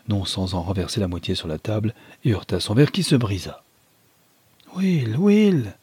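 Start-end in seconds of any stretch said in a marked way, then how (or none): 4.7-5.7 pleading cry. A narrator's reading. Clara pleads with her cousin Will to stop, high pleading cry. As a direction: beg him to stop, voice rising high. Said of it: "avec des yeux suppliants".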